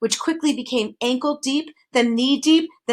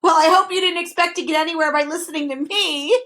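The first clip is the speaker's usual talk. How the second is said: higher gloating taunt; gleeful; 7 semitones higher, 4 dB louder than usual. A narrator's reading. Tricky voice gloating at the fallen boys, gleeful. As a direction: gloat over their fall. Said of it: "said the voice gleefully".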